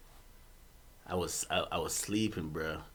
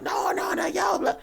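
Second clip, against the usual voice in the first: higher pleading roommate voice; mock pleading; 13 semitones higher, 6 dB quieter than usual. Roommates begging him to stop, mock high plea. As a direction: lightly imitate them begging. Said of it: "they're trying like".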